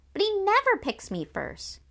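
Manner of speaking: high-pitched complaint; high, put-out objection; aggrieved protest. A narrator's reading. Sister protests the dog's choice.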